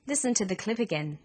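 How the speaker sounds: ordinary talk, nothing marked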